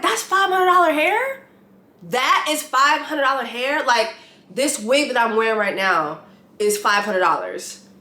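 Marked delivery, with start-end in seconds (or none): 0.0-3.8 high, disbelieving question, twice; can't believe the price of her hair; incredulous. Retold or imitated. Reacting to Raven's hair price in disbelief, high and incredulous. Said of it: "I'm like".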